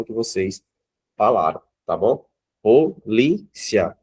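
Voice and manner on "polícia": Polícia spoken very slowly as po-lí-cia, syllables apart with ci-a joined; split into syllables. Shown as a demonstration. Teacher showing pupils how to divide the word, slow and separated. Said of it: "Não separa o I e o A".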